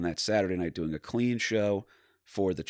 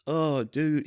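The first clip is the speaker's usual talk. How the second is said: high, louder little stoner voice; little stoner drawl, pitched up; 8 semitones higher, 4 dB louder than usual. Retold or imitated. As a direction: imitate an apologetic stoner dude. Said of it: "he had, like, a little stoner voice".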